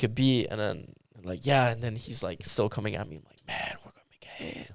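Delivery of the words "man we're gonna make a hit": imitating a hype man in a whisper; hushed hype. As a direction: whisper it like a secret promise. Imitating the hype man's coaxing.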